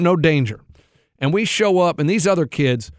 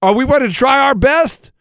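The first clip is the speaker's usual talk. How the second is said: higher, rising hype questions; pep talk; 8 semitones higher, 4 dB louder than usual. Retold or imitated. A coach firing up his five-year-olds in the huddle, rising hype. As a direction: fire up a team of kids.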